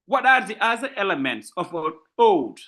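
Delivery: ordinary delivery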